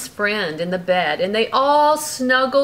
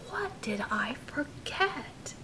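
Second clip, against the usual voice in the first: hushed storybook whisper; 5 semitones higher, 12 dB quieter than usual. Acted. Parent whispering the story to the child. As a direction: whisper it like a secret. Secret bedtime question.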